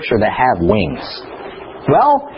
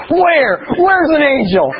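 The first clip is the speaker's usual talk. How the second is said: much higher, eager father asking where; calling out to find it; excited searching; 13 semitones higher, slower than usual. Retold or imitated. Driving father asking his son where, much higher and eager. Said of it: "So I was like".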